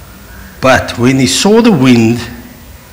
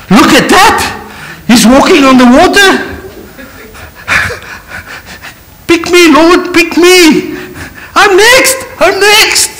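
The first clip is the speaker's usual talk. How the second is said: excited disciples. Retold or imitated. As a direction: beg to be picked next. Disciples eager in boat.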